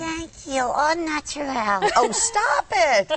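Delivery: high giggly lamb voice; coy puppet, laughing it off; sassy puppet voice. Pretend. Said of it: "very feisty".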